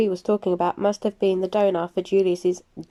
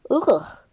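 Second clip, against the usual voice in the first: revolted ugh; 3 semitones higher than usual. Acted. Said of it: "Gabby pulled a revolted face".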